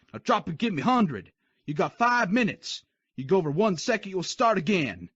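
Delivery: high barking sergeant; drill sergeant. Acted. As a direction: bark the punishment. Sergeant punishes recruit.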